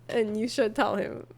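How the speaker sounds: usual speaking voice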